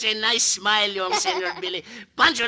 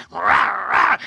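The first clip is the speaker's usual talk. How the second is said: louder mock growl; wolf growl; 3 semitones lower, 5 dB louder than usual. Rooster growls at the wolves, mock growl. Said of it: "he say to the wolves".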